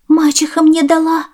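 put-on high, loud girlish answer; high-pitched defensive child; little girl.

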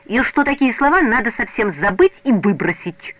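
high, loud, emphatic outburst; boy bursting out with his point; blurted out. A narrator's reading. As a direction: blurt it out in one go. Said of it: "залпом выпалил Петя".

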